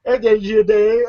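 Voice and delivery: normal talking voice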